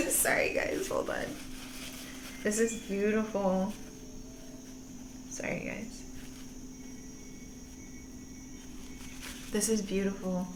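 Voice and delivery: hushed, voiceless, choked apology; soft, barely voiced, holding back crying; teary-eyed. Real, not acted. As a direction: quietly overcome by happy tears. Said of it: "getting teary-eyed".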